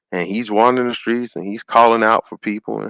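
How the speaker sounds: ordinary talk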